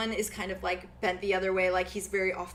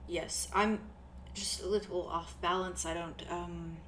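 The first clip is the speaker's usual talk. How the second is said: soft, shy reply; 3 semitones lower, 8 dB quieter than usual. Acted. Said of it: "He's like".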